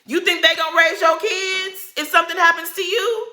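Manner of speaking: high-pitched, incredulous rhetorical question; indignant challenge. Real, not acted. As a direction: throw the question at her in disbelief.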